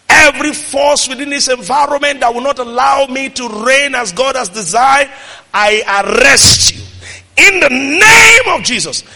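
forceful decree, swelling on the last phrase; preaching declaration. Real, not acted. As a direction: command it like a prayer of authority. Commanding prayer declaration.

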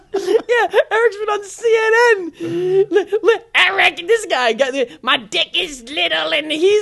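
very high, loud squeaky mimicry; Eric impression. Co-host mocking an absent friend, high squealed impression. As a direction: squeal it slowly like a cartoonish creature. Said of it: "that's what Eric sounded like".

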